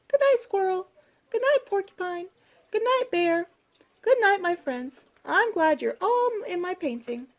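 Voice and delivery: high, sweet bedtime mouse voice; Little Mouse, happy. A narrator's reading. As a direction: say good night happily in a small high voice. Little Mouse says good night.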